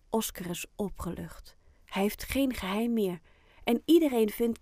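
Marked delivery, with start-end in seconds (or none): none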